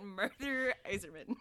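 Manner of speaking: "Murther Iserman" with r-colored MUR-ther, slowly; Murther pronunciation. Retold or imitated. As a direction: drawl "Murther" like "murder". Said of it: "You went".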